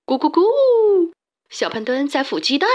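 mock clucking. A narrator's reading. Big Voice clucks to tease Xiaopangdun, mocking. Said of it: "嘲笑".

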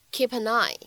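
"keep an eye" run together as kee-pa-neye; linked pronunciation. Shown as a demonstration. Teacher demonstrating two links in "keep an eye", linked pronunciation. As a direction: link P into "an", N into "eye". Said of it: "其中会有两处连读".

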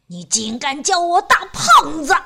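loud indignant yell; furious shout. Acted. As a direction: shout him down in outrage. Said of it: "他对小小先生大喊".

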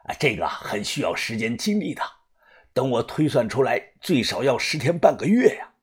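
put-on soft, low elderly murmur; low-voiced. A narrator's reading. Old master explaining the reckoning takes time, low-voiced. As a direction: keep it down, reluctant old master weighing it up. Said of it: "就听偏爷低声的又说".

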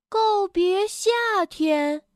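slow, high puzzled echo. Acted. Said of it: "迷惑地看着蹦蹦". (迷惑不解地慢慢反问)

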